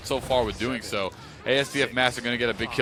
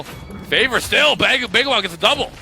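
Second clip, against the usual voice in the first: excited; 7 semitones higher, 5 dB louder than usual. Real, not acted. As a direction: stretch it out, rising hype. Hype call as fight turns.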